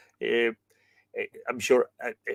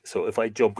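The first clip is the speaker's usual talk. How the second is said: ordinary talk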